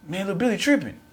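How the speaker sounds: high-pitched kid bystander reacting; imitated onlookers. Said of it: "They like".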